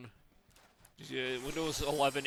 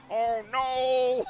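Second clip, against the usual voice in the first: high, loud mock robot cry; 8 semitones higher, 6 dB louder than usual. Imitating a robot flying by the window, robot voice. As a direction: wail it as a plummeting robot. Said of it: "the robot voice going".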